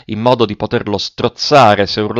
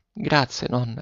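soft, faint thanks; weakening voice; 10 dB quieter than usual. A narrator's reading. Failing boy thanking his grandmother, weakening voice. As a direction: thank her as strength drains away. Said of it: "con la voce sempre più debole".

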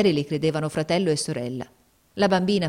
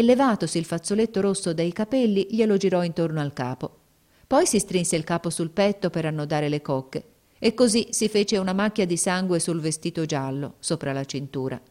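plain reading voice

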